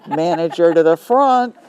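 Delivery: loud call for the manager across the store. Retold or imitated. Clerk loudly calling the manager to the front.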